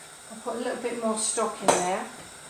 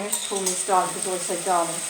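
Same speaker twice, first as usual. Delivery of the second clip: ordinary talk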